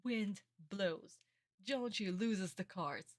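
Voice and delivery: ordinary talk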